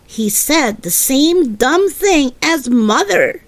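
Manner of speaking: plain reading voice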